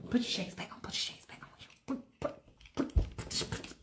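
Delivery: hushed, voiceless repeated order; urgent whisper. Acted. [name] hurriedly hides Michael with his shades, urgent whisper.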